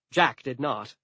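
ordinary delivery

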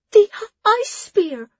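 very high, slow, loud squeak. Acted. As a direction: squeak it out in excitement. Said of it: "Jula almost squeaked".

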